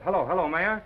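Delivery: high, loud and pushy; eager reporter on phone; eager phone call. Acted. Calling the mayor eagerly.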